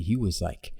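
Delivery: ordinary talk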